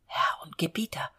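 soft frightened whisper. Acted. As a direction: whisper it, scared of ghosts. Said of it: "flüsterte er leise".

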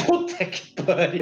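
slow, laughing disbelief. Real, not acted. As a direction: laugh it out slowly. Reacts to absurd joke.

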